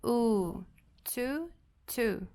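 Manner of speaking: "tu" with a long drawn u; long u sound. Shown as a demonstration. Teacher showing the long u to students. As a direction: stretch the u in "tu". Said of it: "o som longo".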